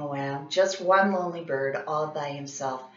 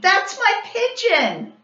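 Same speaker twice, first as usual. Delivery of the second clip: much higher, slow, anguished; upset scream; 6 dB louder, slower than usual. A narrator's reading. Bully crying out about his pet pigeon, upset. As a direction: plead it out in distress. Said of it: "Tony screamed".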